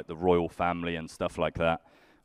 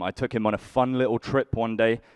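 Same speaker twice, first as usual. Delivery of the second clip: normal talking voice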